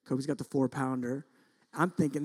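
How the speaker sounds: normal talking voice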